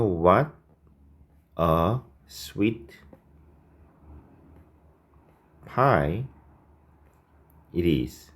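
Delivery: words spaced out with pauses; slow dictation. Shown as a demonstration. Teacher dictating the corrected phrase slowly to students. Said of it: "이렇게 써주시면 되겠습니다".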